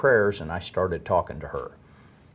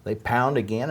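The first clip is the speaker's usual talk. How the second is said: ordinary talk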